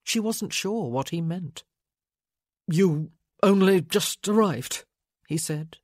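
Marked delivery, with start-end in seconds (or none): 2.7-4.8 slow, broken-up louder delivery; halting, awkward. A narrator's reading. Man awkwardly asking her to stay, halting. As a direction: hesitate after the first word, awkwardly. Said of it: "his voice halting and awkward".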